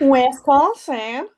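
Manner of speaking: slow drawn-out Midwestern Wisconsin; Midwestern accent. Pretend. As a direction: stretch it out, Midwestern style. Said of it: "my Midwestern accent".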